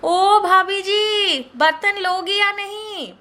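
high, loud, slow calling-out of a street vendor; sharp-voiced hawker at the door; shrill vendor call. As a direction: call from the doorway like a pot-seller hawking. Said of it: "बर्तन वाली की तीखी आवाज".

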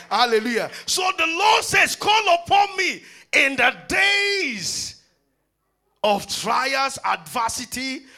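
loud, high, stretched preaching; preaching at full force. Acted. Preacher rousing the congregation, full force.

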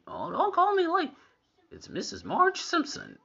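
very high, strained mock indignation; indignant cartoon mom. Viewer imitating the mother rebuking the house, high indignant voice. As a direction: correct them in a high-pitched cartoon voice. Mimics Marge correcting the house.

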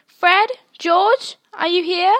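high, loud call through the train door; a mother hollering for her twins. Acted. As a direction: call out for the boys from the platform.

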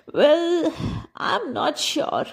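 put-on high, hesitant old woman voice; waver, unconvinced; doubtful old woman. A narrator's reading. Old woman hesitates at the traveller's offer, high and doubtful.

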